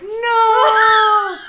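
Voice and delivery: high, loud anguished wail half laughing; despairing cry. Retold or imitated. Wallet card cancelled, despairing cry.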